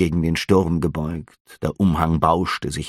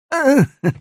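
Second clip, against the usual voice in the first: high nervous little laugh; innkeeper's uneasy chuckle; 5 dB louder than usual. A narrator's reading. Innkeeper laughing off the officer's rebuke, nervous laugh.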